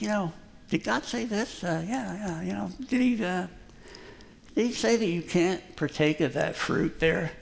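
soft, sly tempter; sly serpent. Retold or imitated. Serpent tempting Eve, soft and sly. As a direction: coax her softly like a sly snake.

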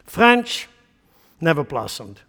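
ordinary talk, nothing marked

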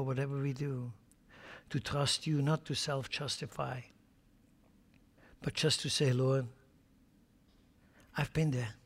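hushed pastoral prayer; closing prayer. Acted. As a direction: pray quietly and earnestly. Leads a closing prayer over music.

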